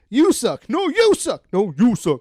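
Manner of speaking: loud, high back-and-forth bickering; volley it like two squabbling cousins; mock childish quarrel. Cousins trading insults, loud hammed-up bickering. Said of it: "Or were they like".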